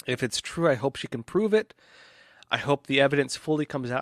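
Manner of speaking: usual speaking voice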